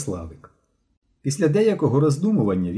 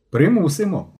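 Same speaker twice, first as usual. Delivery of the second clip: firm, louder, higher retort; resolute; 8 semitones higher, 5 dB louder than usual. A narrator's reading. Classmate warns the boy he will be forced, resolute. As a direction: push it out with determination. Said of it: "рішуче сказав Мишко".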